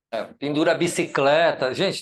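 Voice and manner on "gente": normal talking voice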